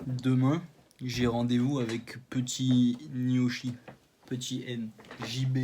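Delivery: slow robotic speech synthesizer voice. Pretend. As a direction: say it like an old robot voice. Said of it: "Je parle comme un robot".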